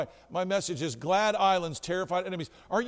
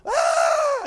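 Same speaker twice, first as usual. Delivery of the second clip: sudden high loud scream; terrified scream; 10 dB louder than usual. Retold or imitated. Body falls out of the closet, audience screams in terror. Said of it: "in sheer terror".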